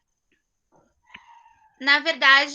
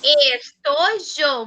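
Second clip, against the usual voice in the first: slow repeat; much slower than usual. Shown as a demonstration. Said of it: "teacher repeats word slowly".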